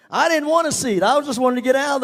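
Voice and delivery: punchline said up and loud; emphatic. Real, not acted. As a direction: push it hard for the laugh. Repeated louder than 40.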